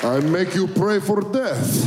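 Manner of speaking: put-on slow menacing Russian accent. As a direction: threaten in a heavy Russian accent. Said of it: "fake a Russian accent".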